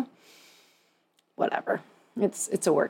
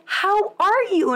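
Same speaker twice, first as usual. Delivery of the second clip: much higher, slow sing-song greeting; a hammed-up bubbly hello; mock chirpy acquaintance; 12 semitones higher, slower than usual. Acquaintance stops her, retold.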